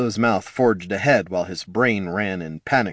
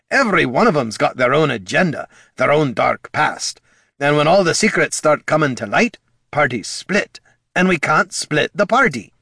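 put-on raised, earnest old dwarf; gruff dwarf voice; 6 semitones higher, 4 dB louder than usual. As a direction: warn like a worried old comrade. Gorm's argument, rising.

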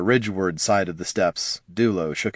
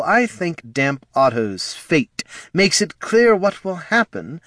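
slow, raised, formal menace; commanding host; 5 semitones higher than usual. Acted. Flynn warning the gathered assassins, slow and commanding. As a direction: lay down the law to the room.

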